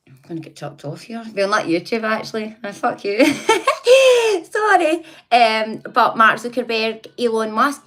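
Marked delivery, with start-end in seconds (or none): 2.6-3.2 laughing. Real, not acted. Caught swearing on YouTube stream. 3.3-4.4 a real helpless laugh, pitched high; high loud burst of laughter. Real, not acted. Laughing at her own slip on stream, high loud laughter.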